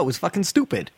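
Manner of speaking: usual speaking voice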